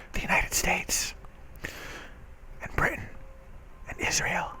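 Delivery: breathy conspiratorial whisper; hushed, knowing aside. Real, not acted. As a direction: let the names slip out like a secret. Conspiratorial aside naming backers.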